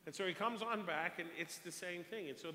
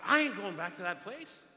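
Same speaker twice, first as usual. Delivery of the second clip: imitated defiant outburst, loud and higher; emphatic retold balking; retold loud refusal; 4 semitones higher, 13 dB louder than usual. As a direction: refuse loudly in his voice.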